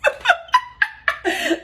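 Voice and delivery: high-pitched burst of laughter; high, helpless giggling laugh; amused laugh. Real, not acted. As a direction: crack up at your own remark. Laughs at her twin remark.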